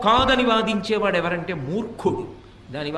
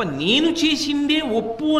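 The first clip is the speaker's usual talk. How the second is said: stubborn insistence; 6 semitones higher, 4 dB louder, slower than usual. Retold or imitated. Stubborn man insists he's right.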